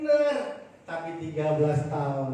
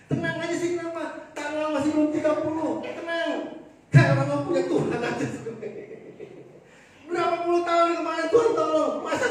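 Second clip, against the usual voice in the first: retold reassuring rebuke; 12 semitones higher, 5 dB louder than usual. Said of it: "Saya bilang ini".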